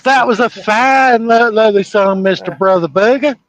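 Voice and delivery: imitating a Southern reverend from a movie; mock Southern preacher. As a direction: ham up the old Baptist pastor. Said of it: "that pastor in the movie Porky's".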